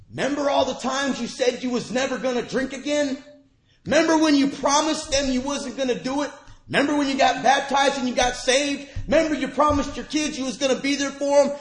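high, loud, urgent taunting thoughts; the mind's voice accusing him, high and loud; accusing inner voice. Retold or imitated. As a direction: hurl the memories back at yourself. Racing accusing thoughts voiced aloud.

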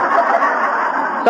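breathy unvoiced chuckle; a quick real laugh at his own joke; short laugh. Real, not acted. Speaker to the meeting, laughing at the hotel story.

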